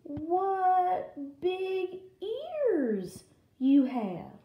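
high, very slow wonder; slow, amazed Little Red. Acted. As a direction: stretch each word in a high girl's voice. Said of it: "she said slow".

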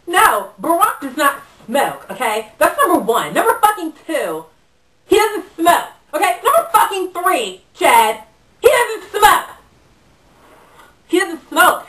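loud, high, emphatic scolding; insistent sibling telling-off; exasperated correction. Acted. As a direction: count off the points, climbing to an indignant shout. Scolding brother Chad point by point.

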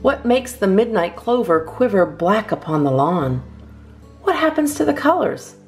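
ordinary delivery

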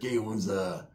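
soft, mumbled homie voice; Charlie Boy's mumble. Retold or imitated. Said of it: "that's how he talked".

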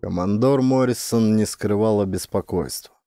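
ordinary delivery, nothing marked

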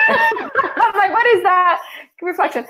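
giggling at her own mix-up; real laughter while retelling her confusion; laughing. Real, not acted.

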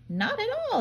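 high, small pebble voice; little pebbles. A narrator's reading. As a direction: answer as tiny cheerful pebbles. Pebbles answer crab.

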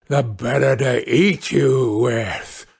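slow, drawn-out threat; hungry wolf savouring each word; menacing wolf. Acted. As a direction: drop the disguise and relish it. Wolf reveals himself.

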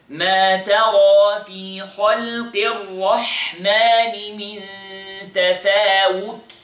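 measured tajweed recitation with long vowels; Quran recitation. Acted. Teacher reciting the verse for students to copy. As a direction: recite it like the teacher's model reading.

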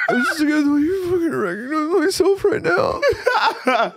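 high hammed-up fake sobbing; mock crying plea. Groveling to his ex in a mock sob. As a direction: whine it like a dumped boyfriend begging. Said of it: "You need to go".

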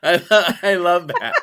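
loud, high, delighted laughing; real laughter bursting into speech. Real, not acted. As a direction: laugh right through the words. Breaking up over grandmother's line.

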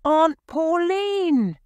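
high, loud and slow call. A narrator's reading. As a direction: call across the yard. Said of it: "she called".

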